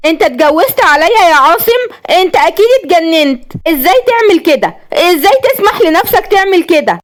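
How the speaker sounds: angry accusation. Acted. Wife confronts husband over his secret marriage, raging. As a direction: hurl the betrayal back at him. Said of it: "عشان تكمل بغضب وتقول".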